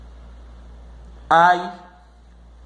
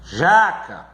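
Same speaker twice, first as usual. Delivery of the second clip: "jaca" with a strong, long JA; JA-ca, punch the first syllable; stress on second-to-last syllable. Shown as a demonstration. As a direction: lean hard on JA in "jaca". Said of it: "é aquela que é a segunda".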